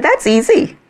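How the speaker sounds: high, loud, laughing relief; gleeful and giddy. Retold or imitated. Woman telling the Lord it's easy, high and laughing. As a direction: toss it off like it's too easy.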